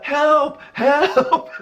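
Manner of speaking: high mock call for help; mock cry for help. Boy pinned under the lady, high mock cry for help. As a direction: cry for help like a trapped kid. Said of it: "I'm like, I'm like".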